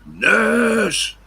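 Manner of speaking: huge high bellow calling the nurse; old man bawling for the nurse; foghorn shout. Retold or imitated. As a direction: bellow it down the ward, very high and loud. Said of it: "Bomi Bulsara was shouting".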